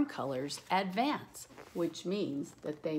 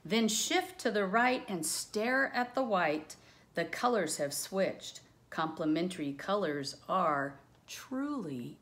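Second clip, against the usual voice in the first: louder, lilting read-aloud of rhyming verse; reading rhyme aloud; 3 semitones higher, 4 dB louder than usual. Acted. Teacher reading a rhyming color book to the class, sing-song. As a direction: bounce through the rhyme like a storybook.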